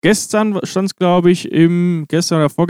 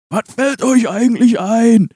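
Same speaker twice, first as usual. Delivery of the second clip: furious halftime rant; 8 semitones higher than usual. Retold or imitated. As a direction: rant at the team like an enraged coach. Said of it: "die Halbzeitansprache für Hoffenheim hätte auch ich halten können".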